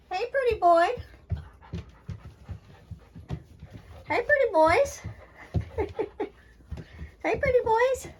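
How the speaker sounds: very high cooing pet voice; high puppy talk. Real, not acted. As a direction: coo up high at the pups. Cooing at the puppies.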